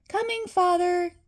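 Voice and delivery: put-on high, childlike voice calling down; a young boy answering his father from the stairs; little boy calling. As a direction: call out like a small boy. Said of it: "called the little boy".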